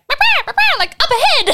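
parrot voice. Pretend. Parrot squawking acted.

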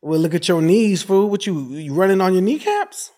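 loud, high comeback roast; exaggerated playful ribbing; making fun back. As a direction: fire back a teasing insult, pitch way up. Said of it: "you made fun of them back".

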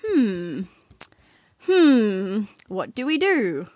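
acted head-scratching; puzzled pondering. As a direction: hum it over like someone out of ideas. Gang pondering aloud in the bush.

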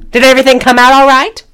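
high, loud sugary question; hammed-up sweet concern; mock motherly concern. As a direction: coo it like a fussing mom. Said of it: "and she goes".

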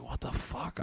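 hushed, breathy shock; whispered disbelief. Retold or imitated. To himself, seeing the views, whispered disbelief. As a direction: whisper it, stunned by the view count.

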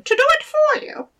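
high squeaky little goblin voice; gravelly sly hobgoblin. Acted. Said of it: "said the hobgoblin".

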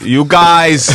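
hammy Yiddish-style delivery. Pretend.

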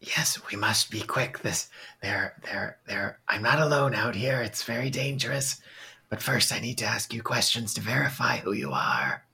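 hushed conspiratorial whisper; sneaking whisper; whisper through door. Acted.

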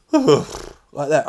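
long heavy sigh; sigh noise. Shown as a demonstration. Showing the checkout sigh. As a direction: let out a big sigh. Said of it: "and I go".

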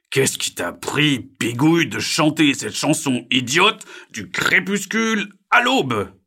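somewhat angry. A narrator's reading. Sleepless Diego scolds singing frog, loud annoyed voice. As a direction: scold loudly, stretching the words out. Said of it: "un peu en colère".

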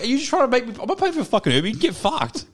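high-pitched, worked-up comeback; exasperated quarrel, acted out; retold indignant refusal. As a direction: tell the mate off in disbelief. Acted phone quarrel, refusing to pay.

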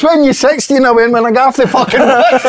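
finishing the bus story in fits of laughter; laughing punchline. Retold or imitated. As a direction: crack up as you deliver the punchline. Retelling punchline while laughing.

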